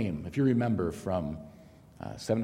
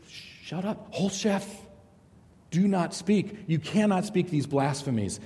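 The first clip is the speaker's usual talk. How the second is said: mock rant, higher; a hint of a scolding aunt, pitched up; imitating a rant; 7 semitones higher than usual. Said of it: "she ranted at me".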